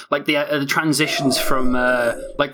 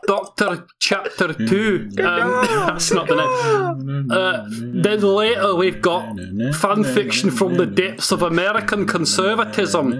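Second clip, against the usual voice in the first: slow, formal show-intro read; announcer voice; 3 semitones higher than usual. Acted. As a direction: announce it like a TV host.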